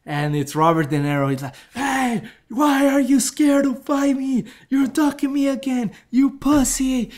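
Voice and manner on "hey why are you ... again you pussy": taunting rival. Retold or imitated. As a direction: goad him like a bully. Rival taunting Rocky, voiced.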